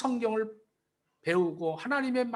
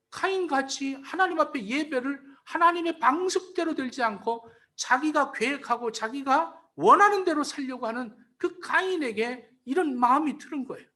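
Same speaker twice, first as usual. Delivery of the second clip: ordinary delivery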